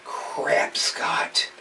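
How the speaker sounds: under-the-breath curse at himself; hissed frustrated mutter. Real, not acted. Fish keeper cursing his mistake under his breath.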